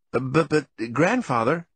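high-pitched, stammered objection; stammering protest. A narrator's reading. Grandson interrupting grandfather's tirade, stammering. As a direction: stumble into a high, hesitant protest.

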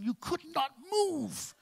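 high squeezed slow voice; high strained. Acted. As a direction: squeak it out trapped. Stuck in woman's brain.

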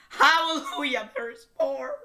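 high, loud mock hallelujah; mock rejoicing. Reader mocks the song, high loud mock joy. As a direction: rejoice with sarcasm.

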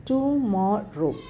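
"Tomorrow" drawn out as to, mor, row; slow syllables. Shown as a demonstration. Teacher showing pronunciation to children, "Tomorrow" slowly syllable by syllable. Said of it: "when you say slowly".

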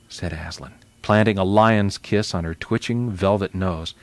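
ordinary delivery

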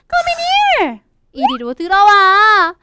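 little fish calling a friend to safety; urgent calling. Acted.

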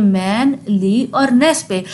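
मैन, ली, नेस said slowly syllable by syllable; syllables split apart. Shown as a demonstration. Teacher breaking मैनलीनेस into syllables for students, slow and separated. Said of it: "ज़बान तो तीन दफ़ा हिल रही है".

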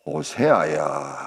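low, slow, drawn-out summons; God calling. Acted. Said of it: "또 하나님은". (낮고 길게 부르는 하나님 음성)

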